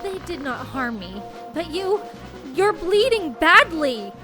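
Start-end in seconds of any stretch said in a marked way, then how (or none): 2.5-4.1 high, loud cry of worry; alarmed. Acted. Sister sees her brother bleeding, alarmed. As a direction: exclaim in fear for them.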